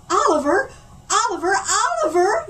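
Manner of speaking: calling the name loud and high; family shouting up the stairs for Oliver. Acted. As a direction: call up the stairs in alarm. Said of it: "shouted Mom".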